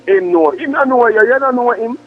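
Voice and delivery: normal talking voice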